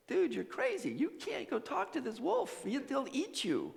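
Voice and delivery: high-pitched, hushed protest; acted high-voiced disbelief; incredulous townspeople. Townspeople object to Francis's plan.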